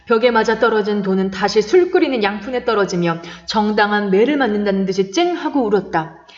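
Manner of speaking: usual speaking voice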